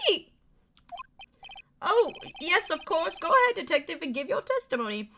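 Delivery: high, hurried judge giving way; flustered judge. Acted. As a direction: cave in like a timid old judge. Voicing the flustered game judge.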